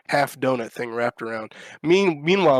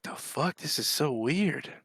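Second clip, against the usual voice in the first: muttered to self; 2 semitones higher, 9 dB quieter, slower than usual. Retold or imitated. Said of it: "I'm like".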